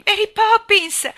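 very high, loud, drawn-out call; desperate scream. Acted. Jane with eyes shut calling Mary Poppins, screaming. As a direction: scream the name for rescue. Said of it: "gridò ancora".

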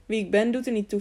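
ordinary delivery, nothing marked

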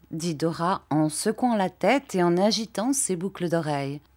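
usual speaking voice

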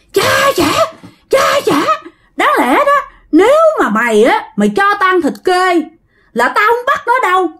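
high-pitched, indignant threats; acted outrage of a vengeful spirit; threatening spirit. A narrator's reading. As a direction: pitch it high and menace the family. Enraged spirit threatens the family.

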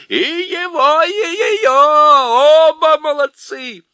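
very high, loud cheer; cheering creatures. A narrator's reading. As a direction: whoop like a silly crowd. Chorus cheering. (ликующий хор, очень высоко и громко)

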